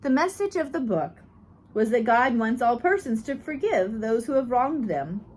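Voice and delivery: ordinary delivery, nothing marked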